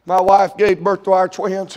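ordinary talk

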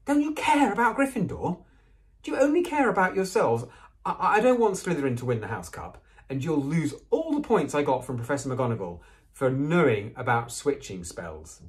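high indignant scolding, easing toward the end; heated high-pitched telling-off, gradually settling; angry hissing. A narrator's reading. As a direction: scold them like an angry goose. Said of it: "hissing at them like an angry goose".

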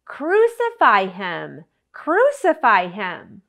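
loud, slow crowd chant; shouting. Acted. Crowd demanding Jesus' death from Pilate, shouting. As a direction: shout it as a relentless, drawn-out demand. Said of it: "but they kept shouting".

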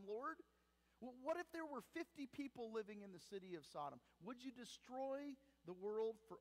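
pleading Abraham. Retold or imitated. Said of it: "pleading and making this case".

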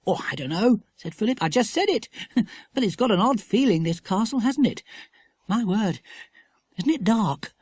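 put-on a boy feeling the odd mood of the castle; boy character. A narrator's reading.

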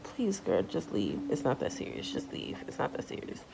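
hushed "just leave"; whispered plea. Retold or imitated. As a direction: beg under your breath. Pleading thought, whispered.

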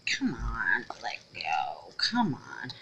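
straining to unmold it, whispering; coaxing whisper. Real, not acted. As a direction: urge it out under your breath. Coaxing the stuck mold.

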